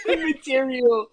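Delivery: slow word through laughter; laughing. Real, not acted. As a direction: repeat it cracking up. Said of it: "you'd be dead".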